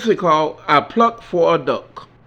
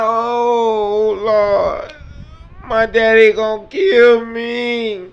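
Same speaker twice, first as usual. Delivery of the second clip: mock cry; 5 semitones higher, much slower than usual. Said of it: "The little boy cried".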